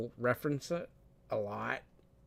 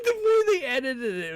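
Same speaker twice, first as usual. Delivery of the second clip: cracking up; 9 semitones higher than usual. Real, not acted. Cracking up at clip.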